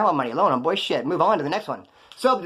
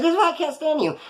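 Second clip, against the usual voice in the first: high-pitched mocking girlfriend voice; mock exasperated whine from a girlfriend; angry girlfriend; 11 semitones higher than usual. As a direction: imitate her complaint way up high. Mimics angry girlfriend mid-fight.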